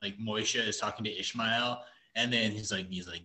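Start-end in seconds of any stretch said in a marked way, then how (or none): none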